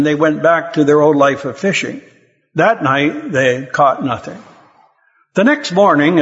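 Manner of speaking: usual speaking voice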